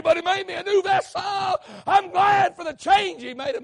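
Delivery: loud, high shouted testimony building to a roar; preaching at full force. Real, not acted. Preacher shouting his testimony to the congregation at full force.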